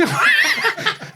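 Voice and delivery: high burst of laughter. Real, not acted. Laughing at the story.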